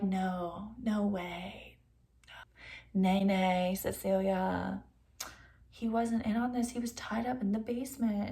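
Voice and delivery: soft, hushed denial with a whispered start; lightly voiced hushed officers; hushed cops. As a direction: play the cops quiet and hushed. Cops calmly denying her claim.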